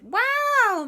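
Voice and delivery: squealed high loud wow. Acted. JB amazed by the kids' news, squealing high. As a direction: gasp it out amazed. JB reacts in story.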